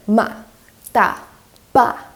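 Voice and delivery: ma, da, ba falling firmly, like a statement. Shown as a demonstration. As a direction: drop ma short and firm, affirming. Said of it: "falling tone".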